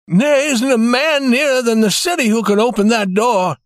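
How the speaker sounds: put-on old banker shaken and helpless; shaking voice. A narrator's reading. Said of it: "in a shaking voice".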